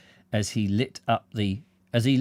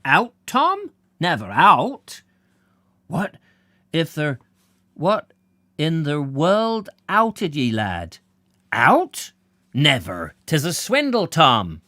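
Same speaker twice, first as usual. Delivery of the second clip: high, loud country protest; indignant rustic voices; 5 semitones higher, 5 dB louder, slower than usual. Acted. Villagers protesting loudly to Tom. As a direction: cry out at the swindle.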